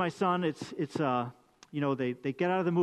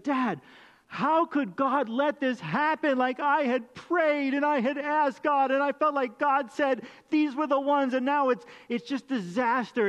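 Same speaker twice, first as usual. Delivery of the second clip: high, slow, pleading and distraught; upset daughter; 7 semitones higher, 3 dB louder than usual. Retold or imitated. Retelling his upset daughter's plea to her dad, high and distraught. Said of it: "and she's upset".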